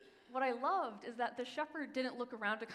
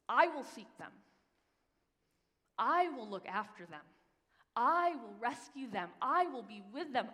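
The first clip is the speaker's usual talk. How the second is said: louder, measured, ringing promises; proclaiming scripture; 6 dB louder than usual. Acted. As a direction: declare each "I will" with weight.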